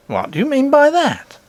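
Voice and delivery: high, bright-pitched worried nurse voice. Acted. Nurse scolding the princess for hiding, high voice.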